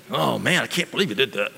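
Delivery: "Oh man, I can't believe you did that" as a soft, dropping mock complaint; mock upset. Preacher mocking the ungrateful reaction, mock upset. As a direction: pretend to grumble at the favour. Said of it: "Was I all upset?".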